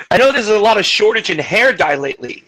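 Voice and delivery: ordinary delivery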